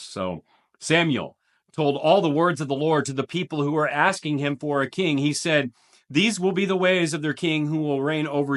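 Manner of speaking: measured, dramatic Bible reading; solemn scripture read to the congregation. Acted. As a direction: read the passage like a proclamation. Reads scripture passage aloud.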